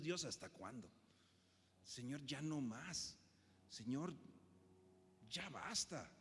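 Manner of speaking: soft, slow, worn-out plea; weary prayer. Acted. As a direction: beg God quietly, at the end of your rope. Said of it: "le dices a Dios".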